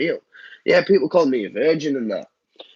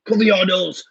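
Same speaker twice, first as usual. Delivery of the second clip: raised, higher mock scolding shout; going mad, telling off; 7 semitones higher, 5 dB louder than usual. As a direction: yell it like an exasperated parent. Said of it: "I'd go mad at them as well".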